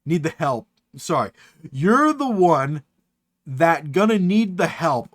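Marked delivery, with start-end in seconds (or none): 1.7-5.1 drawn out "You're the one", deliberate pacing; slow, weighty message reading; slow emphatic reread. Acted. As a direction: read it out slowly and pointedly. Rereads it slowly and pointedly.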